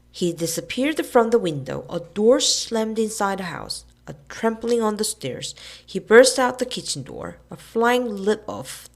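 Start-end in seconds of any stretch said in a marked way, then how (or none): none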